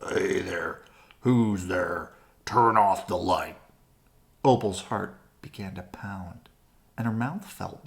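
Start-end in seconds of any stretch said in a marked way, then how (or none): 0.1-3.6 low, grumpy cave voice rising to a louder snap; grouchy voice. Acted. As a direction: grumble it like a woken creature. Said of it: "a grouchy voice echoed".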